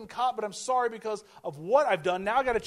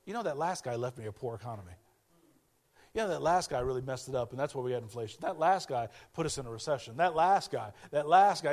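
lower, softer grumbling excuse; lightly voiced politician passing the buck; mock blame-shifting; 8 semitones lower, 4 dB quieter than usual. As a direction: mutter the blame onto someone else. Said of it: "You know what they do?".

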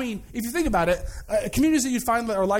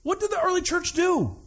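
higher, emphatic repeat of the question; 8 semitones higher than usual. Shown as a demonstration. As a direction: ask it again, much higher. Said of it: "repeated question".

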